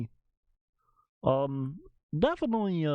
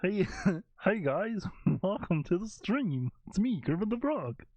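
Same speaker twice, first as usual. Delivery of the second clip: Kermit the Frog; 7 semitones higher than usual. Acted. Streamer shows off a frog impression for viewers, high puppet voice. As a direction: laugh through a high-pitched frog puppet greeting. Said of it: "I do a good Kermit the Frog".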